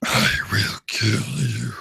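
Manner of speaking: slow, deep robot-villain threat; Megatron. Shown as a demonstration. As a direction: drag it out in a deep villain growl. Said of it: "talk like Megatron".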